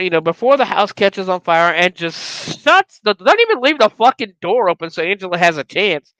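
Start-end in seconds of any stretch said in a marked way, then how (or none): none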